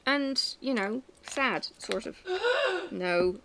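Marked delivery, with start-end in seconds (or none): 2.2-2.8 sharp high gasp of fake shock; mock gasp. Wife teasing husband with a fake gasp. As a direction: gasp in playful mock offence.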